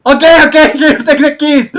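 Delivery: high, loud frantic surrender; panicked giving in. Retold or imitated. Car owner to robber: high frantic surrender. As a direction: hand over the keys in a panic.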